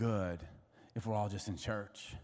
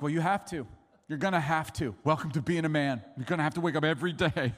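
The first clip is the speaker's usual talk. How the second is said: loud, insistent retold lecture; exasperated father, raised voice; firm dad; 7 semitones higher, 6 dB louder than usual. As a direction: tell your son there is no way out. Dad insisting to crying son.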